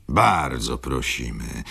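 ordinary delivery, nothing marked

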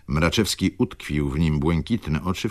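ordinary delivery, nothing marked

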